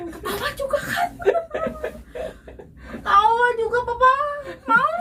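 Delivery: high, laughing through the words; can't stop laughing while talking. Real, not acted. Telling Papa she laughed too, high and laughing.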